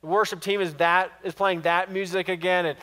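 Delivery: hammed-up moan about the pastor; a grumbling churchgoer mock-complaining, rising to a loud whine. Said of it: "you're like".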